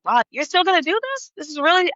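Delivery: high, surprised "wow"; parents' surprise. Retold or imitated. Parents surprised she is still going, high and startled. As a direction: sound high and taken aback. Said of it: "they were like".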